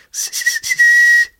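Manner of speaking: very high thin whistle; whistling. A narrator's reading. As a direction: whistle like a tiny bird. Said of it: "a zapískal". (vysoké tenké pískání)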